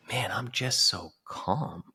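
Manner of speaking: slow near whisper, client at peace; hushed calm. Retold or imitated. Client telling coach on call how calm he feels, slow hush. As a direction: breathe it out slowly, totally at ease.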